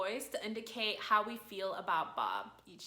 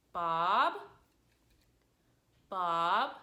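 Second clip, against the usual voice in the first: "Bob" starting low and sliding up, loud; questioning rising tone; 3 semitones lower, 6 dB louder than usual. Shown as a demonstration. Owner checking another room, "Bob" rising questioningly. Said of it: "You're questioning if Bob is in that room".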